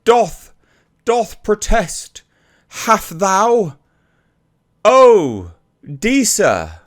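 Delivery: high, hammy mock-Shakespearean declaiming; sneering parody of faux-Elizabethan theatre; fake thespian. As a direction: overact it like a pompous bad stage actor. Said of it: "as a fake thespian".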